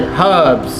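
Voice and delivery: "Herbs" with a strong H; H sounded. Shown as a demonstration. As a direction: lean on the H of "Herbs". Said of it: "Pronounce it".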